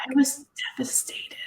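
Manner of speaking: breathy dramatic whisper; hushed, mock-devastated whisper. Real, not acted. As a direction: whisper it like a confession.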